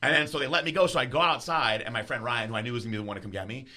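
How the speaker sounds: ordinary talk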